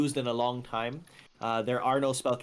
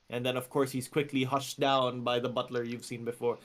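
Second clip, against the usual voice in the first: usual speaking voice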